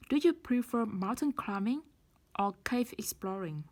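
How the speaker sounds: climbing rises, exploring falls; rise then fall choice. Shown as a demonstration. Teacher models the choice question with rise then fall. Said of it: "rising intonation ... falling intonation".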